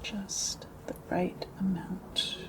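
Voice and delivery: quiet ASMR echo, unhurried; hushed repeat. Real, not acted. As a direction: savour each word very softly. ASMR repeat, softer and slower.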